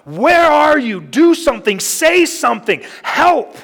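loud, high, desperate cry to God; yelling at God. Retold or imitated. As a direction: shout it up at heaven in anguish. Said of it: "yelling at the top of my lungs at God".

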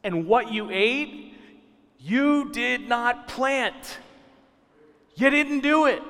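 rising preacher's insistence; emphatic preaching. Acted. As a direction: drive the point home, stretching the words then hitting hard. Preacher builds to his main point.

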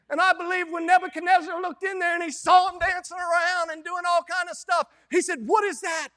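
loud, rising sermon cry; preaching at full force. Acted. Preacher firing up the congregation, loud and high. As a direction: preach it up, voice climbing.

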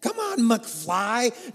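a put-on fed-up rebuke, slowing right down at the end; exasperated scolding. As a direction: scold him for being so thick-headed. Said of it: "No, Jesus does not say this".

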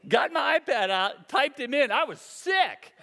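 ordinary talk, nothing marked

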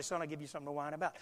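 stern father. Retold or imitated. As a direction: rattle off a dad's quiet warning under the voice. Imitates dad threatening a whining child.

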